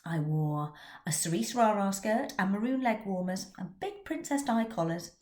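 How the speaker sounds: normal talking voice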